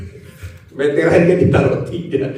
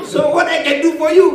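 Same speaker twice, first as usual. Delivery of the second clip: mock voice of God; 10 semitones higher than usual. Pendeta memerankan suara Tuhan.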